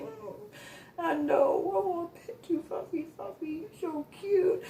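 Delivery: high, hushed cooing; baby talk. Retold or imitated. To the dog, high soft baby talk. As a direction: coo at the fluffy dog.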